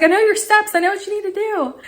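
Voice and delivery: high, excited assurance; eager. Retold or imitated. Customer to tech who just called, eager. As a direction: rush it out high and keen.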